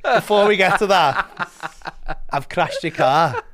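loud, slow, through laughter; slow admission breaking up with laughs; laughing confession. Retold or imitated. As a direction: draw out the retold confession while laughing.

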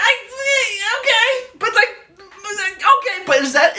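high, laughing protest; real amused disbelief. Real, not acted.

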